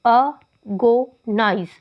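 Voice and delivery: "agonize" broken into three slow, separate syllables; teacher counting out the syllables of "agonize". Shown as a demonstration. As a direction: say "agonize" as a... go... nize, pausing after each. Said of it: "तीन syllable हो गए".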